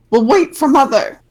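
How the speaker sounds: high, loud monkey voice. Acted. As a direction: squeak it out like a little monkey. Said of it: "he says".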